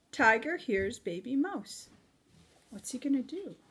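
usual speaking voice

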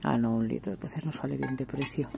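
ordinary delivery

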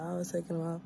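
ordinary talk

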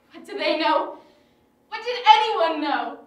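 loud, bitter cry; loud, grief-stricken anger at everyone; bitter outburst. Acted. Grieving widow lashes out.

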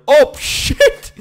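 very high, loud shriek; real shocked outburst; startled scream. Real, not acted. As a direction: scream in sudden alarm. Sudden attack in game.

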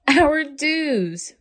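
high mock boo-hoo wail. Mother boo-hooing over the ruined hairdos. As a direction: whimper it like a hammed-up cry. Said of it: "boo-hooed Ava's mother".

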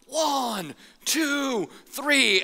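high called-out count. Retold or imitated. Lifeguard calling the count to kids, high and sung out.

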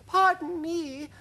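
very high, slow, polite voice; tiny high-pitched insect, drawn out; timid ladybird. Acted. Ladybird politely interrupting the others, high timid voice. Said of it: "murmured the ladybird".

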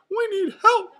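very high, loud falsetto call; Mickey Mouse cry. A narrator's reading. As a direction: cry for help as a cartoon mouse. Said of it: "cried Mickey".

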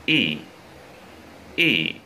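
Y drawn out as a tense, smiling "ee"; long sharp i. Shown as a demonstration. Teacher models the long vowel for students, long sharp i on Y. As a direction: say Y as a long, sharp "ee" with lips spread. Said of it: "nó phải sắc, nó phải dài".

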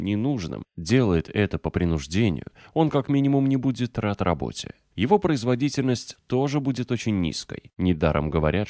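usual speaking voice

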